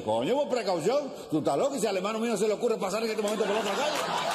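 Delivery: high-pitched emphatic retort; indignant driver. Acted.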